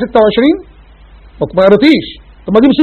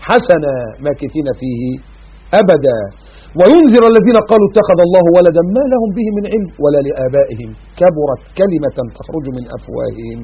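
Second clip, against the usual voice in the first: Quran recitation; 3 semitones lower than usual. Acted. Recites Surat al-Kahf.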